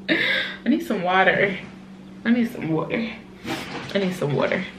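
tearful, trying to compose herself; catching her breath through tears; teary recovery. Real, not acted. Mom collecting herself on camera after crying, tearful. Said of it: "I'm really crying".

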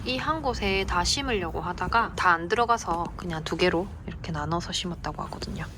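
ordinary talk

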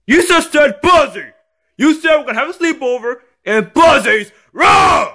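high-pitched accusing shout; angry kid yelling. Acted. Kid correcting dad about the room, yelling. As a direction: build to a yell on the last word.

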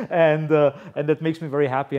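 loud laugh into the words. Real, not acted. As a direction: laugh it out, then speak fast. Laughing after naming distributors.

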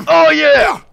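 high, loud cheer; excited shout. Real, not acted. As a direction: whoop with delight. Character powers up.

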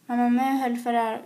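ordinary delivery

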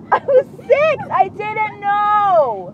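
high, drawn-out plea; whiny self-defence; defensive protest. Real, not acted. Defends herself against teasing.

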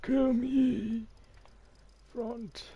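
very high despairing squeal; mock despair. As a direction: wail it like comic agony. (sehr hohe, gespielte Verzweiflung)

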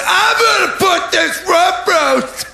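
high shouting market trader; hammed-up market seller yell; meat van hawker.

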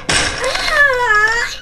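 high, drawn-out cry of pain; acted scream. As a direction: yelp as if hit. Hit by thrown chairs.

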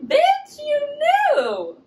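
very high, loud sassy call-out; high sassy yell. Retold or imitated. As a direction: squeal it with attitude. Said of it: "it's like".

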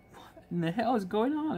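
high, incredulous question; baffled. Retold or imitated. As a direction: ask what on earth is happening. Kitchen staff baffled by the commotion.

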